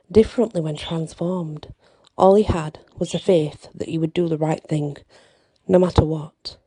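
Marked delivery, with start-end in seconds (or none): none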